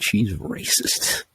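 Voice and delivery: slow whispered aside. Real, not acted. Telling his friend about the tow lady, slow whisper. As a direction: lean in and breathe it slowly, voiceless.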